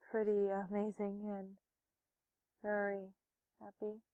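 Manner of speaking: soft, halting, sighing reminiscence; wistful. Real, not acted. Remembering playing with a lost best friend, wistful. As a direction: recall it quietly, with sad pauses.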